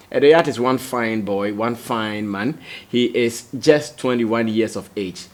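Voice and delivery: ordinary delivery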